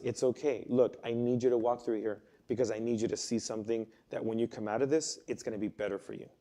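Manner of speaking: put-on God gently soothing his scared children, softly; soft gentle reassurance. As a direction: hush it, like calming a frightened child. God voiced reassuring scared believers.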